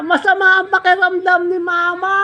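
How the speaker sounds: high, loud woman's call. Retold or imitated. Woman in ambulance calling to the man, high. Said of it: "sabi niya".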